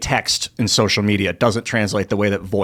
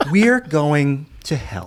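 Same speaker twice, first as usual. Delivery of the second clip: higher, very slow mock Trudeau; Trudeau impression; 5 semitones higher, much slower than usual. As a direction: stretch it out with a smug smile. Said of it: "Trudeau's like".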